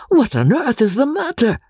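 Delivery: high, baffled question; bewildered. A narrator's reading. The professor, baffled, asks his hurrying friend why. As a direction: ask it utterly bewildered. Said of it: "in a state of the extremest bewilderment".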